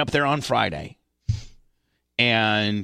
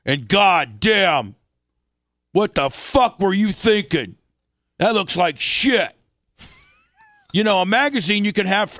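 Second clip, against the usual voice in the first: slow, louder scolding dad voice; angry dad; 8 semitones higher, 4 dB louder than usual. Retold or imitated. Father scolding his son over the magazine, slow and angry. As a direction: scold the kid slowly and hard.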